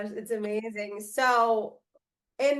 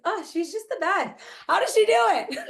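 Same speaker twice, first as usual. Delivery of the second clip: high, marveling burst, louder at the end; gushing admiration; 10 semitones higher, 5 dB louder, faster than usual. Retold or imitated. Yoga student raving about a beloved teacher, gushing. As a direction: gush in awe, breaking into a laugh. Retelling her gushing reaction to praise.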